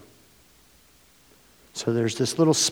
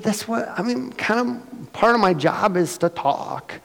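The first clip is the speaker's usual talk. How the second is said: defensive retort; 9 semitones higher than usual. Retold or imitated. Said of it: "I said".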